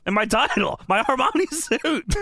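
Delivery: high-pitched acted grievance; mock indignant complaint. Host voicing robbed wrestler's complaint, high mock indignation. Kurt complains of stolen suit.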